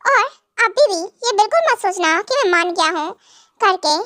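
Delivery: usual speaking voice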